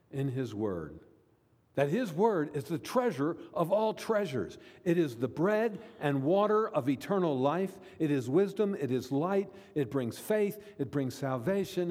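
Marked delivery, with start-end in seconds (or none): 1.7-11.9 raised, higher-pitched preaching cadence; emphatic preaching. Real, not acted. Preacher extolling God's Word to the congregation, emphatic. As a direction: proclaim each phrase with rising force.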